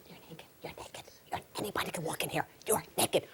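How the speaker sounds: urgent, breath-only warning; horrified husband whisper. Acted. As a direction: whisper it in embarrassed alarm. Shocked husband whispers to wife.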